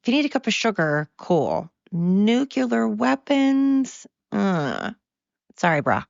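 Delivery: high, sing-song brush-off, slowed on the weapons question; sweetly decline, then shrug it off; lilting fake-friendly voice saying no; mock polite refusal. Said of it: "We should probably be like".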